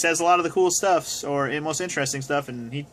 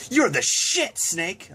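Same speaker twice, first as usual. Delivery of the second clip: much higher, awed nerdy fanboy squeal; imitating Otacon; 6 semitones higher, much slower than usual. Fan quotes Otacon's favorite line about Snake, high awed squeal. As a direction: gush at Snake like a starstruck sidekick.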